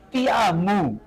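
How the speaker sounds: "Te amo" ending in a clear u, "ti amu"; final o as u. Shown as a demonstration. Teacher repeating the Andean example, "amo" said as "amu". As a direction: close the o of "amo" into u. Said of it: "así con la U todavía".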